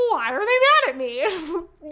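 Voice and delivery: put-on confused young man, drawn out; clueless whine. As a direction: play the oblivious hero asking innocently. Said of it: "he's like".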